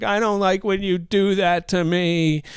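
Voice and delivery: put-on weepy protest; mock tearful whine. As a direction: whine it as if bursting into tears. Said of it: "And she starts crying".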